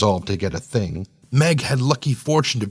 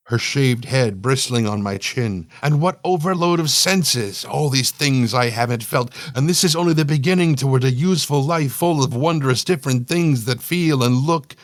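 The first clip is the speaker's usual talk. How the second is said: plain reading voice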